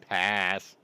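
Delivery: firm loud no-thanks. Real, not acted. Refusing an overpriced gun.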